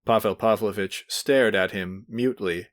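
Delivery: ordinary delivery, nothing marked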